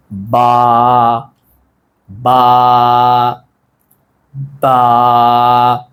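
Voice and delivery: बा with the vowel held long on a level note; stretched letter. Shown as a demonstration. Teacher models the letter for learners, बा held long and level. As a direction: draw बा out in a sustained monotone. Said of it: "बा को हम खींच क्यों रहे हैं".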